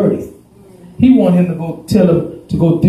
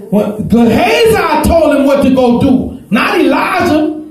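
high, slow, shouted pulpit peak; preaching shout; 9 semitones higher, slower than usual. Acted. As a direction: cry it out to the congregation.

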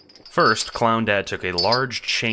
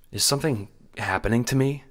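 put-on higher, uncertain child's voice; worried child; 6 semitones higher, faster than usual. A narrator's reading. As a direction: ask it anxiously in a child's higher voice. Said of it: "worry in their large eyes".

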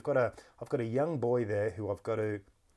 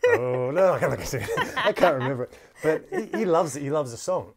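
laughing it off; 5 semitones higher, 10 dB louder than usual. Real, not acted. Asked to sing, laughs it off.

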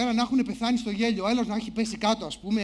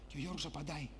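ordinary delivery

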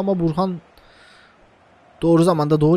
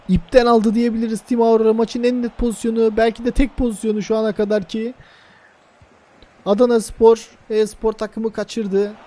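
ordinary delivery

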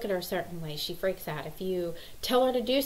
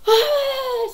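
loud, high imitated shriek; high-pitched put-on "ahh"; mock scream; 13 dB louder than usual. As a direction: mimic a frustrated child's long scream. Daughter's scream in reply imitated.

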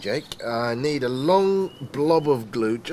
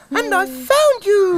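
high, fast chick voice; tiny squeaky chick; cheeping chick; 5 dB louder than usual. Acted. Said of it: "cheeped little chick".

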